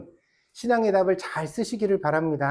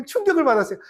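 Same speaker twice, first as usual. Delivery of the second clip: ordinary talk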